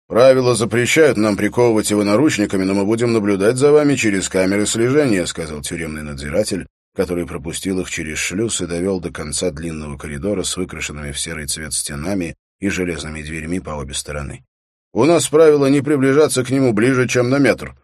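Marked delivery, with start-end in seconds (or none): 0.1-5.3 loud, raised official voice; official prison guard. A narrator's reading. Prison guard briefing visiting policemen, loud and official. 14.9-17.7 loud, raised official voice; official prison guard. A narrator's reading. Prison guard briefing visiting policemen, loud and official.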